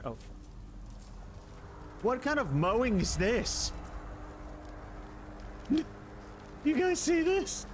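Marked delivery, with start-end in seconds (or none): 2.0-7.7 high, squeaky stunned outburst, pitch way up; can't believe what's on screen; shocked disbelief. Real, not acted. Shocked by weird mowing in game.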